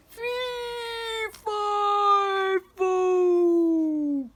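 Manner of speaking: long, high wail sliding downward; falling giant's cry. A narrator's reading. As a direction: howl each word while plummeting.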